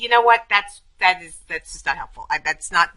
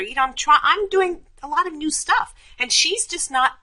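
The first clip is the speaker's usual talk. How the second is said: higher, building frustration over the unfair sister-in-law; frustrated venting; 9 semitones higher than usual. Acted. Venting to a dismissive friend about her sister-in-law, frustrated. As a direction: push the complaint up, near whining. Role-play vent, listener ignoring her.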